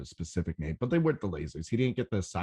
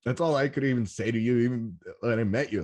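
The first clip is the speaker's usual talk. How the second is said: usual speaking voice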